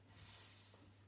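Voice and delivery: "s" as a long unvoiced hiss; isolated letter sound. Shown as a demonstration. Teacher breaking down soit for pupils, long "s" sound. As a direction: hold just the sound "s", very soft, no vowel. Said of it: "on entend".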